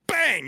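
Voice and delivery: sudden high-pitched bang noise; imitated bang. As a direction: burst it out high, like something popping. Imitating AirPods shooting out.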